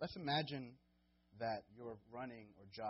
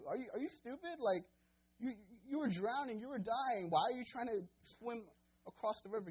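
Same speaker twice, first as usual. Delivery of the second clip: much higher and louder, incredulous; mock exasperated scolding; 11 semitones higher, 7 dB louder than usual. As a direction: scold him like a fool who should know better. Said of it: "I'd be like".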